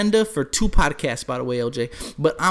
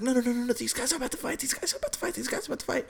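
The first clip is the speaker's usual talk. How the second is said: high, softer eager whisper-like urging; hushed excitement; 8 semitones higher, 9 dB quieter, much faster than usual. Retold or imitated. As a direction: urge quietly and high that a fight is coming. Eager to watch fight, hushed.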